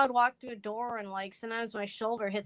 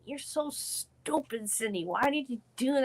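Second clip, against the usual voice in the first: harsh inner critic; 4 semitones higher than usual. Retold or imitated. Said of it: "instead of saying".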